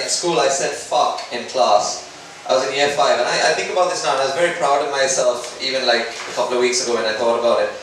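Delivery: ordinary delivery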